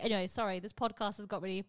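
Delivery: ordinary delivery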